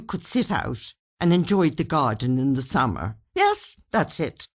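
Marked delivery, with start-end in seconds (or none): 3.4-4.5 a character's pleased reply, pitched high; lightly voiced Bert, pleased; Bert's voice. Bert agreeing on the phone, lightly voiced and pleased.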